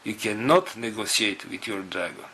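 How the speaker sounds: ordinary talk, nothing marked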